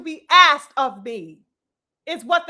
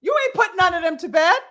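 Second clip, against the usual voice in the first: ordinary delivery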